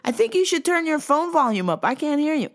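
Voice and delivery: squeaky mock customer. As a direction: squeak it like a clueless lady. Caller voiced in retelling.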